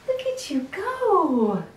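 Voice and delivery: high, slow sing-song praise; talking to a dog. Real, not acted. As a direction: coo it to the puppy.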